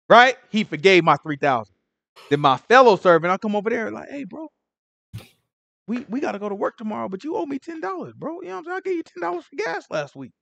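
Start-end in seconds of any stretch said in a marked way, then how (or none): 4.1-10.3 high, soft, needling ask for the money; nagging coworker. Retold or imitated. Servant nags his fellow worker for ten dollars, high and hushed. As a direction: pester him, pitched up but hushed.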